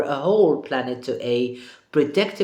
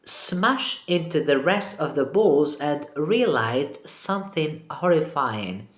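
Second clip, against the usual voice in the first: ordinary talk, nothing marked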